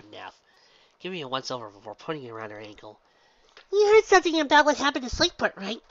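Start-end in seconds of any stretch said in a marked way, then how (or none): 3.7-5.8 very high, loud bubbly pony voice; Pinky, exclaiming. Acted. Pinky introduces herself to the gym leader, very high and bubbly. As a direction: chirp it out, much higher and louder.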